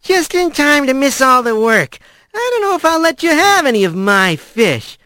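sour Fisher. A narrator's reading. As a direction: needle him sourly. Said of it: "a sour expression".

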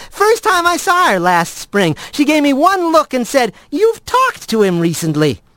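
high-pitched, self-justifying friend; cheeky friend Blaze. Acted. Blaze excuses telling Kylie to Rat, cheeky and high. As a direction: start high and teasing, ease down into the quote.

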